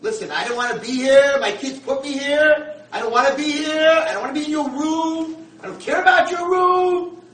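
high-pitched, full-volume tirade; furious acted shouting, very loud and high; screaming at him. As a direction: scream it out, dragging the words. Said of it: "He starts screaming at him".

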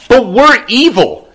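high, slow protest of unworthiness; Shlish pleading. Acted. Officer to Elisha, high-pitched insistence. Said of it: "said the Shlish to Elisha".

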